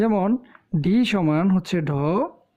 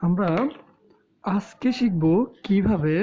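normal talking voice

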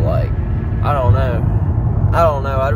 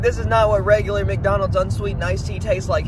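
higher whiny complaining customer voice; mocking whiny customer; 8 semitones higher than usual. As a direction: whine like a fussy customer. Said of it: "He was like".

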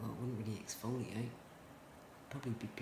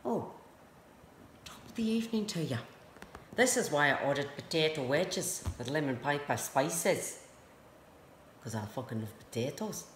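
put-on stoned Irish accent; 3 semitones higher, 5 dB louder than usual. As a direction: put on a cheesy Irish accent for the pizza guy. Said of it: "Maybe I'll put in an accent".

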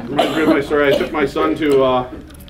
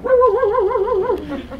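much higher silly "woo" calls; mock playful call. Mother chasing her little daughter, high playful woo. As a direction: chase a toddler with a singsong woo. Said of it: "chasing her daughter around with it going".

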